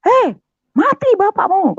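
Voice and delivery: very high, loud alarmed yell; mother's shout. Retold or imitated. Mother yelling at her child over the father, imitated shout. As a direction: imitate a mother screaming out a warning. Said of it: "Sampai ibu saya teriak".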